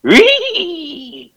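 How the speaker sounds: high, giddy cartoon-dog squeal; Scooby-Doo impression. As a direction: yelp like a happy cartoon dog. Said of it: "my best impression of Scooby-Doo".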